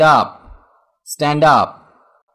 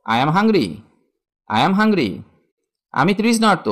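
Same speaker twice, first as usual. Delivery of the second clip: ordinary talk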